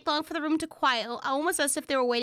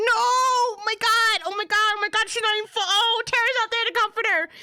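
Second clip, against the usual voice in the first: loud, much higher, thrilled shouting; excited shouting; 7 semitones higher, 6 dB louder than usual. Real, not acted. Gamer reacting to a friend comforting her, excited shouts. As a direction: cheer it like your favourite scene just landed.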